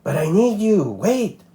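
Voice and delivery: high pleading lizard voice. A narrator's reading. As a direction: beg her to stay, a touch desperate.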